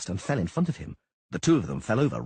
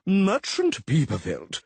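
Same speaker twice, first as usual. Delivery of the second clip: slow, loud crowing address; crowing official; 8 semitones higher, 5 dB louder, much slower than usual. Acted. Official crowing at the merchant, eyes narrowed. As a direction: crow the name slowly. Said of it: "Schwann crowed".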